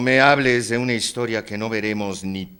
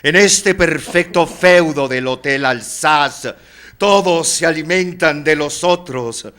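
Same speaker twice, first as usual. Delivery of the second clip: loud, high, theatrical declamation; grand proclamation; 6 semitones higher, 8 dB louder than usual. Acted. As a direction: proclaim it grandly, drawing out the final words. Proclaims Oscar will be immortal. (proclamación teatral, fuerte y aguda)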